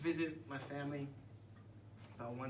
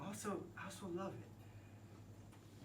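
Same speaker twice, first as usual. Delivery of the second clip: tender near-whisper; 5 semitones higher, 9 dB quieter, slower than usual. Real, not acted. Tender confession about time.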